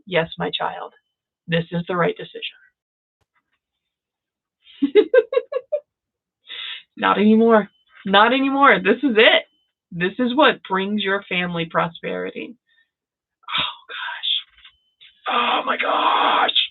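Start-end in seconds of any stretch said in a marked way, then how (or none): 0.1-2.6 soft, understated voice of the universe; the universe speaking. Acted. Universe reassures its child, soft understated voice. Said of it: "This is the universe going". 13.6-16.6 breathy, drawn-out whispered exclamation; overwhelmed. Real, not acted. Reader overcome by client's good news, overwhelmed whisper.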